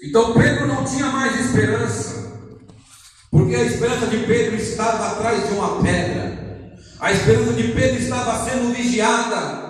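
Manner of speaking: high-pitched, driving sermon delivery; impassioned preaching. Acted. Preaches at full force.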